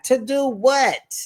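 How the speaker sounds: high, slow disbelieving question with a laugh; what on earth, laughing at her; mock disbelief breaking into laughter; incredulous. Real, not acted. Mocking the auntie's threat.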